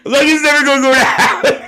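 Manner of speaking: can barely get the words out for laughing; laughing hard. Real, not acted.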